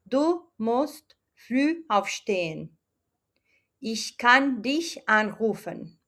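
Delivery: slow, careful German sentences; slow model sentences; slow German examples. Shown as a demonstration. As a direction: read each example slowly for learners. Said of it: "Aquí tenemos unos ejemplos".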